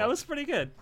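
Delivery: high, put-on silly voice; mock voice. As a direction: parrot it back in a goofy voice. Mocking praise in odd voice.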